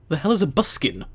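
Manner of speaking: ordinary delivery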